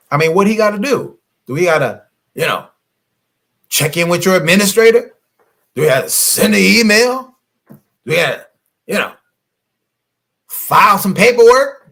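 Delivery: loud, high sarcastic disbelief; mock incredulous. Coach mocking couples who schedule sex, loud and sarcastic. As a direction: ridicule the idea of booking time for love.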